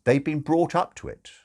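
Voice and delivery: ordinary delivery, nothing marked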